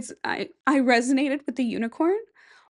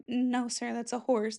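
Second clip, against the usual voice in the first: softer, timid correction of the king; quiet courtier; 8 dB quieter, slower than usual. Retold or imitated. Courtiers quietly tell the king he is wrong, softly. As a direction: gently set the king straight.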